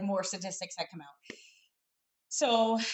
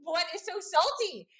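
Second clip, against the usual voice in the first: mock freaking out; 5 semitones higher, 3 dB louder, much slower than usual. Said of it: "Like, freaking out".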